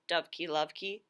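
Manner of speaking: plain reading voice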